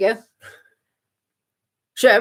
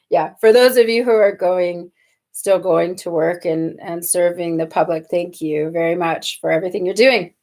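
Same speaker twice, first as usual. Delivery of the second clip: lower, sincere thank-you; serious normal voice; 6 semitones lower than usual. Real, not acted. Host thanks those serving the public, serious normal voice. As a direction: drop the act and thank them earnestly. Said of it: "serious, normal voice".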